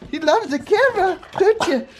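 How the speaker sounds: very high squeaky coo at the dog. Real, not acted. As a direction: coo it like to a tiny puppy. Cooing to the puppy.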